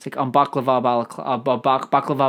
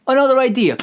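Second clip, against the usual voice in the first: another idea, higher and louder; louder excited repeat; 9 semitones higher, 6 dB louder than usual. Shown as a demonstration. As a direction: burst out with the idea.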